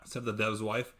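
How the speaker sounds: ordinary delivery, nothing marked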